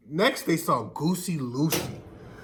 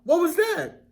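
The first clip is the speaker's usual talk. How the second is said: much higher, startled little hen voice; 10 semitones higher than usual. A narrator's reading. Hen wonders what hit her, in a high startled voice. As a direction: squeak it out like a frightened hen.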